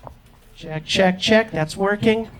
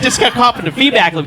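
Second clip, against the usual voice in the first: usual speaking voice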